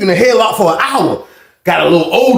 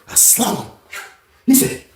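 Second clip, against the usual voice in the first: ordinary talk, nothing marked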